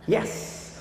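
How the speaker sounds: a soft, self-satisfied whispered yes; smug. Real, not acted. Boasting to the audience about his school, a smug whispered yes. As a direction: whisper it, proud of yourself. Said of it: "Eu sou assim, vaidoso".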